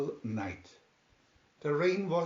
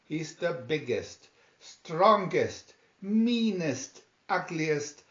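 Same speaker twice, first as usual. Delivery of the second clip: high, loud, eager pitch; eager cat; 5 semitones higher, 5 dB louder than usual. A narrator's reading. Smartest cat rallying the other cats, louder and higher. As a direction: jump up and pitch the plan excitedly. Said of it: "jumped up and said".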